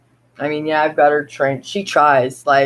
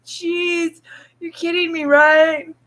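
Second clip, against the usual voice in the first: higher mock-incredulous voice; voicing the goldfish; 9 semitones higher, slower than usual. Owner voicing goldfish unimpressed by people, mock incredulous. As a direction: scoff as the smart fish. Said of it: "And it's like".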